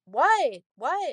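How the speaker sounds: mock yell back; calling back. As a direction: imitate answering someone calling from another room. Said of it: "I'm, you know, responding to her".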